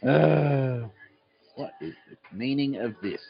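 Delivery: lightly hammed-up evil villain voice; a drawn-out "uh", then put on a mock villain. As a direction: play at being the evil villain. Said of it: "I could use my evil villain voice".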